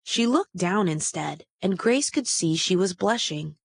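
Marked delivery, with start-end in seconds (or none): none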